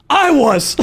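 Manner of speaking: high-pitched imitation of a yelled boast; mock screaming. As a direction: imitate a drunk friend yelling back. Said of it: "Like, just screaming".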